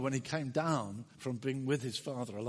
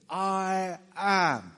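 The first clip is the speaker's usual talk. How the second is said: I AM, both words loud, raised and drawn out; emphatic; 5 semitones higher, 10 dB louder than usual. Shown as a demonstration. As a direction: declare "I am" with full weight on each word. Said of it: "you're saying something emphatically".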